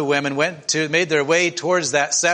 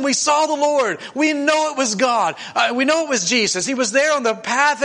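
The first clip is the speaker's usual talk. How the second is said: excited disciples; 8 semitones higher than usual. Acted. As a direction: blurt it out like you just saw him alive. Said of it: "they got so excited".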